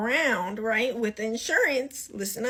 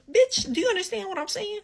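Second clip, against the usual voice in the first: high-pitched disbelieving rant, much higher; exasperated outburst; 9 semitones higher than usual. Real, not acted. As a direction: burst out in fed-up disbelief, voice jumping way up.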